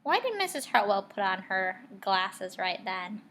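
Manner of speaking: normal talking voice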